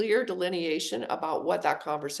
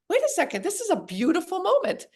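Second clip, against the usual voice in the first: sudden realization; 11 semitones higher than usual. Retold or imitated. Inner thought, realization.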